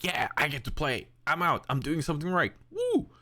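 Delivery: high, bouncy puppy talk; cute dog voice. Retold or imitated. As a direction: chirp it like an excited puppy. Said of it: "when you use the cute voice".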